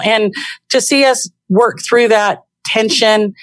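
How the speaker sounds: ordinary talk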